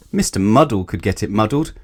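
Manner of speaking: ordinary delivery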